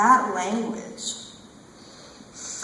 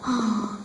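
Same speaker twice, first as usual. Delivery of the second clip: wistful sigh. Acted. Performer missing moaning in law, sighing. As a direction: sigh before the confession.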